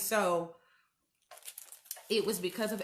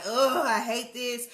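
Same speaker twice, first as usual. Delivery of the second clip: frustrated groan; 3 semitones higher, 5 dB louder than usual. Retold or imitated. Quoting her own frustration.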